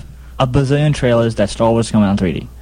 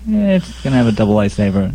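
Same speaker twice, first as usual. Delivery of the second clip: lower, shrugging indifference; unimpressed; 5 semitones lower than usual. Retold or imitated. Moviegoer who knew the twist already, acted indifference. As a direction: shrug it off as old news. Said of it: "But if you know what's coming".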